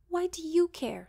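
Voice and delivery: loud, high, bitter snap; scowling. Acted. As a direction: snap it back resentfully. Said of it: "she scowled".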